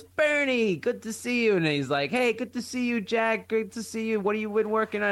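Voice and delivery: high, bright-voiced old acquaintance; cheery greeting. Train acquaintance greeting the father, hearty and high. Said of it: "Guy says to him, he goes".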